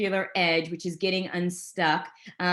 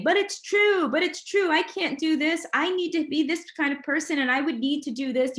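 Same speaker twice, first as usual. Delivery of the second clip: high, whiny mock objection, pitched way up; protesting listener; 9 semitones higher than usual. Coach mimics a doubting audience member, high whiny protest. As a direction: imitate a doubter pleading it's true. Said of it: "you're gonna argue with me saying".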